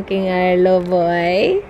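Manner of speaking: ordinary delivery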